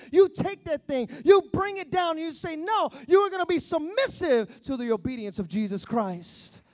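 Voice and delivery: loud, driving sermon delivery building to a climax; forceful preaching. Real, not acted. Preacher urging listeners to resist deception, loud and forceful. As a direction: hammer it home to the congregation.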